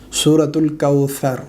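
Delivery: ordinary talk, nothing marked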